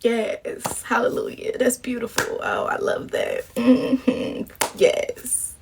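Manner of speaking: whispered fake delight; soft sarcastic whisper of approval; hushed mock praise. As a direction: breathe the praise under your breath. Mock reading the letter, hushed.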